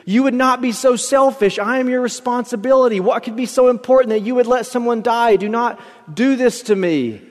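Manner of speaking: high, urgent plea at moderate volume; mild desperate shriek. A narrator's reading. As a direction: beg the man above not to abandon you. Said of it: "the other shrieked".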